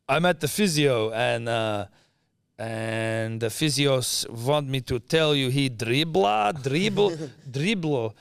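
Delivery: slow mock Croatian-accented broken English. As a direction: drawl out "dribbler" like a mother-in-law reading a text. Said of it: "like, in broken English".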